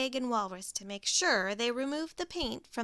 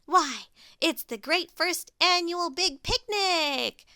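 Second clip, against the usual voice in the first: slow, higher and louder call; Pig shouting; 6 semitones higher, 7 dB louder than usual. Acted. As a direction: shout it out to the street. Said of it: "shouted Pig".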